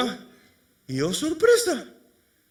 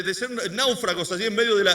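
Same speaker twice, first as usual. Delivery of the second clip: ordinary talk, nothing marked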